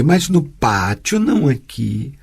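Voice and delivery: usual speaking voice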